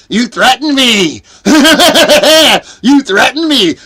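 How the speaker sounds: high, loud taunt broken by a cackle; mocking taunt. Snafu Snaps gloating at the speaker with a mocking laugh. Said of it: "Snafu Snaps is going".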